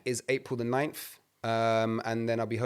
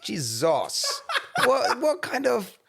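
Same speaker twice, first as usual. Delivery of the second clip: laughing through the words, pitched up; 12 semitones higher, much slower than usual. Real, not acted. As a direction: break into laughter mid-protest. Mock-offended, laughing.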